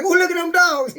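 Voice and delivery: high, laughing "look at them dogs"; laughing onlooker. Retold or imitated. Man laughing at dogs in the street, amused. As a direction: point and laugh at the mating dogs. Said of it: "You be like laughing".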